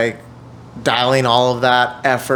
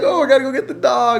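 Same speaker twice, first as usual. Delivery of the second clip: put-on wobbly old wife; 11 semitones higher, faster than usual. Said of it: "She's like".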